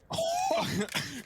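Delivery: high, sudden burst of laughter; surprised laugh. Real, not acted. Caster laughs as the round turns, surprised laugh.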